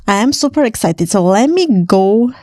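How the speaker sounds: ordinary delivery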